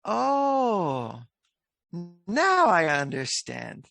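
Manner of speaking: surprised realization. Retold or imitated. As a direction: act out a sudden dawning. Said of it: "he said".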